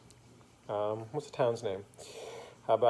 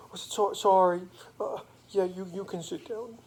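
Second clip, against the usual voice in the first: put-on higher, halting little-girl voice; sniffly little girl; 6 semitones higher, 4 dB louder than usual. Little girl lets Mister Magoo sit down, sniffly and halting. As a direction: lightly play a girl who just stopped crying. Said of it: "sniffled up and stopped her crying".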